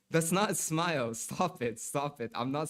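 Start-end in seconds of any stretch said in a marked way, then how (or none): none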